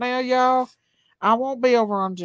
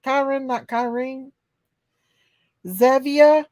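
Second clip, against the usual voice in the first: ordinary delivery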